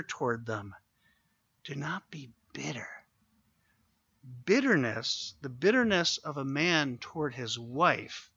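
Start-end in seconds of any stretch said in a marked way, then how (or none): 1.6-2.9 whisper. Shown as a demonstration. Repeats verse as hushed whisper.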